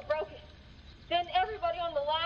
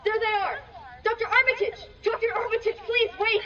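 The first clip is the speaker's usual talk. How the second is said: higher, louder desperate shout across a field; calling out; 6 semitones higher, 6 dB louder than usual. Acted. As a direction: yell after the doctor to stop him. Villager calling out to doctor.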